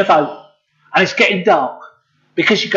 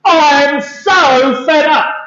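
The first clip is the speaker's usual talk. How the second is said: higher-pitched, drawn-out lament; fed up; 6 semitones higher, slower than usual. Acted. Peter laments to the children listening, fed up. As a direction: wail it slowly, thoroughly fed up.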